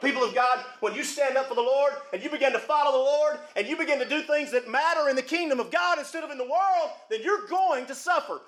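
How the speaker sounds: rising preacher's cadence over the church; preaching. Acted. Pastor warning his church, in preaching cadence. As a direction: preach it out to the congregation. Preaches to congregation about suffering.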